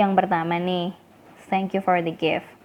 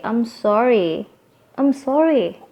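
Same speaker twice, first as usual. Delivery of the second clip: "I'm sorry" with a lifted, falling tune on "sorry"; a light everyday apology, "sorry" rising then falling; intonasi maaf; 3 semitones higher, slower than usual. Shown as a demonstration. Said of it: "kalau kita menekankan intonasinya". ("I'm sorry" dengan intonasi naik lalu turun)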